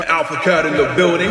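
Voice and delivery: light DJ announcer call; DJ hype. Retold or imitated. DJ announcing him in the bar, light hype. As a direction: hype the crowd on the mic. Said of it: "Now he hops on the mic".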